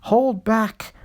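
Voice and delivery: usual speaking voice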